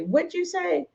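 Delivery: high, sharply rising question; mock parent demanding a repeat; challenging parent. Parent talking with the kids, challenging high-pitched question.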